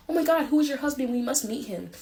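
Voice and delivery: excited gush. Acted.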